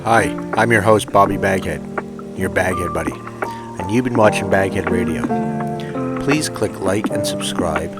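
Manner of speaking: usual speaking voice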